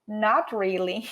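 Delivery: Not really with a laugh; laughing refusal. Shown as a demonstration. Teacher acts out refusing a movie invite: laughing refusal. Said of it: "a entonação do not really".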